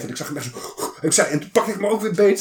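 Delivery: ordinary delivery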